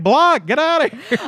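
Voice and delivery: high, loud imitation of shooing someone off; mock dismissal. Acts out dad rejecting incoming texts.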